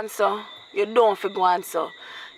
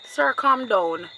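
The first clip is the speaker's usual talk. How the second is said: ordinary talk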